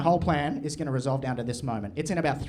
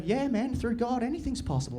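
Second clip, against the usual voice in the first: higher, softer pious reply; 7 semitones higher, 4 dB quieter than usual. Retold or imitated. Bully replying about God, higher soft pious earnestness. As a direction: answer with naive sincerity. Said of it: "And he'll go".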